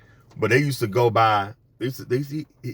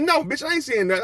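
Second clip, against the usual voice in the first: higher, sassy comeback; mock retort. Comedian retells telling off the Karen, higher sassy tone. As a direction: snap back at the lady. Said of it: "I said".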